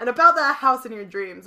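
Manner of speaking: detective voice. Acted.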